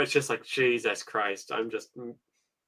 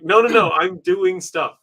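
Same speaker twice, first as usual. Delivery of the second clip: mock exasperation; 5 semitones higher, 6 dB louder, slower than usual. Retelling brushing off birthday callers.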